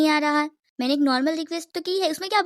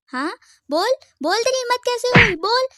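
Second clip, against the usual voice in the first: furious demand; 8 semitones higher than usual. Acted. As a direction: keep hammering her for an answer. Demanding answer during a fight. (ऊँची आवाज़ में गुस्से से बार-बार पूछना)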